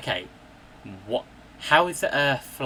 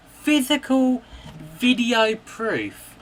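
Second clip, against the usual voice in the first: much higher and louder, slow; furious outburst; 9 semitones higher, 4 dB louder than usual. Real, not acted. Telling flat earthers about the NASA footage, yelling high and loud. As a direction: yell it in disbelief.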